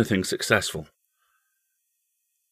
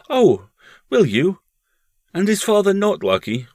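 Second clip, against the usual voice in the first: put-on high, louder child's questions; small boy, raised pitch; young boy; 12 semitones higher, 6 dB louder than usual. A narrator's reading. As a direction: pipe up as an eager-to-know boy. Boy questions his mother.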